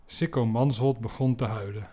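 ordinary talk, nothing marked

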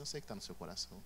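soft, fast, knowing hush; knowing quiet voice. Acted. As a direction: say it low like you see right through them. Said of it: "ele olha pra você e fala assim". (voz baixa de quem sabe tudo)

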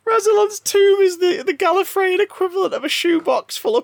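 high, grand tour-guide voice; mock dramatic. Host hamming up a game description for the co-host. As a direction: announce it like a lore reveal.

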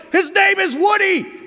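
high, full-throated acted kid's scream. Older son to his brother in a toy argument, screaming. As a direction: scream it at your little brother in exasperation. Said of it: "[name] screams".

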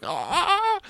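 high, loud mock scream of pain; grandpa's scream. Grandpa yelps loudly when the turtle bites him. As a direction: yelp as if bitten. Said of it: "and the grandpa going".

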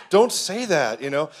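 scolding wife. Retold or imitated. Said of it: "Heather's kicking me under the table".

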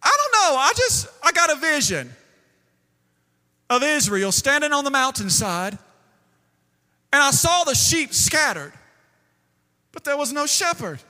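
the prophet blurts out his vision, alarmed; frantic prophet. Retold or imitated. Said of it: "He said".

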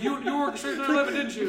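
high accusing interrogator, laughing. Retold or imitated. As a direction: accuse him, barely holding a laugh. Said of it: "It's like".

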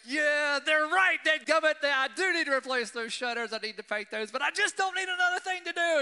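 loud, high, exasperated grumble at the shutters; retold frustration. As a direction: vent it like a fed-up homeowner. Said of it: "And so I start getting angry".

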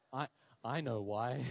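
low, halting guilty admission; mock-guilty low aside; sheepish confession. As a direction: admit it haltingly, down in pitch. Sheepish confession to puzzled teacher.

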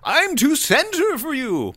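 mock smug journalist, rising high; playful mock of a smug pundit; mocking Tim Pool. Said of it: "like, calm and, like".

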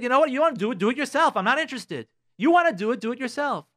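imitated bossy retort, high and quick; taking control. Spouse taking control in a tit for tat, dismissive retort. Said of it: "you took control and you said".

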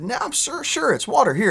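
high, hammed-up insisting driver; insisting drunk. Driver to cop, a high insistent hammed-up denial. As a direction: oversell the innocence, pitched high.

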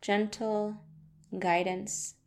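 guided meditation. Acted. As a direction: ease them gently into stillness. Leads a guided chakra meditation.

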